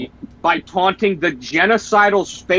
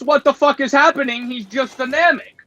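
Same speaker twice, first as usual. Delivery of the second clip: Frieza voice; 8 semitones higher than usual. Retold or imitated.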